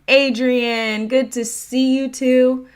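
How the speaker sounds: loud, high, drawn-out hello; calling out a greeting. Real, not acted. Greeting viewers as they join.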